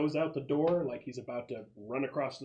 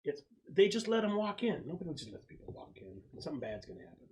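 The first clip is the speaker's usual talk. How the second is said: nervous warning; 5 semitones higher than usual. Acted. GM voicing nervous Bad Vibes.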